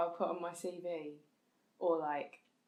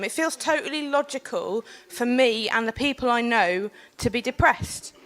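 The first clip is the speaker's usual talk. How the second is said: earnest public speech to a panel; formal speech; 13 dB louder than usual. Acted. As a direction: speak it like a prepared talk. Delivers prepared panel speech.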